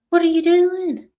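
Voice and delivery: high, loud mock telling-off; self-scolding. Woman staying angry online confronts herself, scolding.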